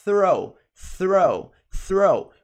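"throw" opening on a long airy TH; TH sound demonstration. Shown as a demonstration. Teacher telling learners how to say it, emphatic "throw". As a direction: drill "throw" in separate, falling takes. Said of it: "pra fazer esse som, você vai falar".

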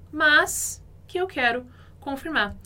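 usual speaking voice

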